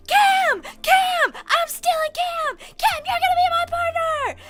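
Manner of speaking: imitating a gleeful shout, very high and loud; excited shouting. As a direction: scream it like you just won the lottery. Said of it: "he goes".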